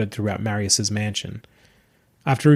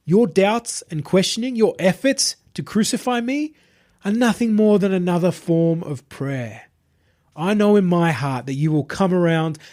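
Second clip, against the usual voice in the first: higher, calm, self-satisfied preacher voice; smug self-proclaimed messiah; 6 semitones higher than usual. Acted. Possessed streamer addressing his entourage, smug and serene. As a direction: announce your divinity like a smooth cult leader.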